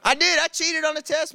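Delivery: emphatic loud confession; loud admission. Real, not acted. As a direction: blurt it out proudly. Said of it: "emphatic confession, loud".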